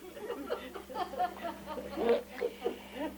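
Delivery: a long high real laugh breaking out. Real, not acted. Friar laughing at the absurd ad, a long high laugh. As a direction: laugh at the ridiculous radio ad. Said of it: "I did laugh".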